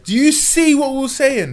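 high, loud, squealed reproach; imitating upset mum. Mum scolding son over results, shrill reproach. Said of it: "when your mum can't speak, she's like".

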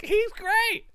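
very high squeaky reply; mock-cheerful, pitched right up; acted reply. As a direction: answer in a tiny high voice. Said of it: "And I was like".

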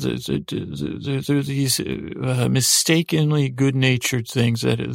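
normal talking voice

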